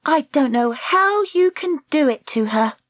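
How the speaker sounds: put-on high-pitched, scolding girl; indignant young heroine; reproachful. Dakota reproaches Lassiter.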